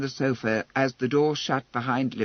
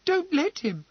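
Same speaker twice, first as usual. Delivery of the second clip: panicked pleading; 13 semitones higher than usual. A narrator's reading.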